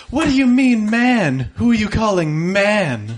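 high, offended vamp voice; indignant femme fatale. Acted. Zabini indignant, raised pitch.

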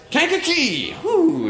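high-pitched whoop of delight; mock triumphant cheer. Stranded driver spots town lights, high delighted cheer. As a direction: cry it out high like a rescued traveler.

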